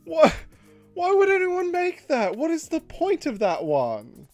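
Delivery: normal talking voice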